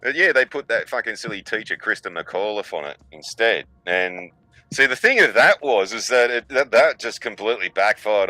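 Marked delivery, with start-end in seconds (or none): none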